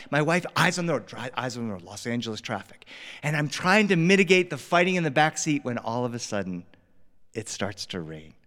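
plain reading voice

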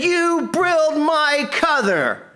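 high, slow, furious mock yell; angry yelling. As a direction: repeat the hammed-up rage, slow and high. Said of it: "He said".

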